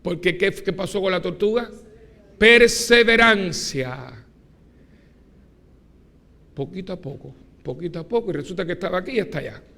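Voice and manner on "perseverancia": very loud, high, stretched out slowly; booming sermon climax. Real, not acted. Preacher proclaiming perseverance to the congregation, booming. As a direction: proclaim the word to the whole church.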